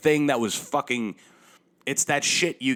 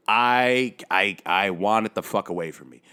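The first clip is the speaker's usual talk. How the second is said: ordinary talk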